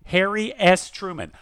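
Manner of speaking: normal talking voice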